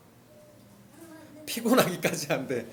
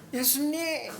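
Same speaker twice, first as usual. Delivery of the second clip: pleading cry; 7 semitones higher, 5 dB quieter, much slower than usual. Retold or imitated. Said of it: "이러고 있는 것 같아요".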